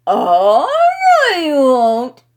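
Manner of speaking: put-on loud drunken protest. A narrator's reading. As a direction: protest loudly. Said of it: "I protested".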